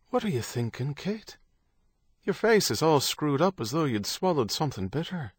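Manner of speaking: a hint of a man's concerned, teasing curiosity; lightly voiced Stephen. A narrator's reading. Stephen asks Kate about her screwed-up face, lightly voiced concern.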